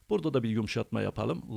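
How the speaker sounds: ordinary talk, nothing marked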